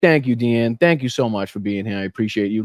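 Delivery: ordinary talk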